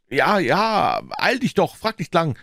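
put-on high, frantic boy's voice; high-pitched, agitated urging; urgent pleading. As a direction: hurry her along in panic. Boy urges aunt to hurry.

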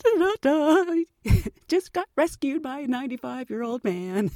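sing-song embarrassed thought; sheepish inner voice. Retold or imitated.

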